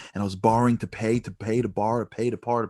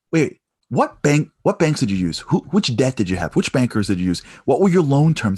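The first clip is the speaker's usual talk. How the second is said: pressing; 3 semitones higher, 7 dB louder than usual. Retold or imitated. Stranger pressing questions.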